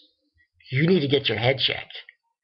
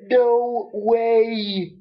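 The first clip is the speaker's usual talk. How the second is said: higher, stunned "what, no way"; shocked disbelief; 7 semitones higher, much slower than usual. Retold or imitated. Watching a teacher pull a knife, stunned and high. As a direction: blurt it out in disbelief. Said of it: "and I'm like".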